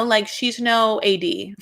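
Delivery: ordinary talk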